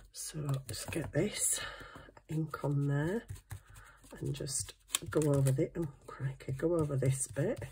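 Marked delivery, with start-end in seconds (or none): 0.1-4.7 whispering. Real, not acted. Concentrating on stenciling.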